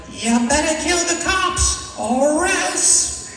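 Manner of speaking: put-on very high-pitched, helium-squeaky botched New York accent; bad New York accent. Comic re-enacts his hitman movie lines, terrible squeaky New York accent. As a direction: commit fully to a ridiculous high New York gangster voice. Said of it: "I just had to commit to it".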